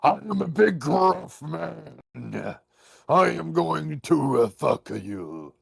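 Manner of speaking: high, slow mock tough guy; hammed-up slow brute; gruff man voice. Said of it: "It shouldn't just be like".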